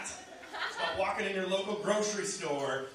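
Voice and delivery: ordinary delivery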